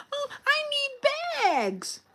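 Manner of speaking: high, drawn-out mock whine; helpless mock plea. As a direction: mimic someone helplessly hunting around. Mimics helpless coworkers looking around.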